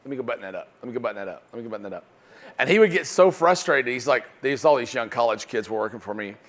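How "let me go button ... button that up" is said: quiet, urgent repeated plea to the boss; an eager salesman hushes a plea to his boss; eager hushed plea. Retold or imitated. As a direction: beg softly to close the sale. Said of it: "and Ken's going".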